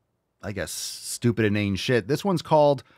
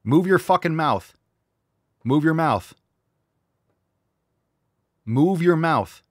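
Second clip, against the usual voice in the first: loud frustrated shout at the screen; angry yelling; 5 semitones higher, 4 dB louder than usual. Real, not acted. Yelling at a news anchor to open her mouth, angry. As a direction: yell it at the video, fed up. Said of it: "I'm upset at this".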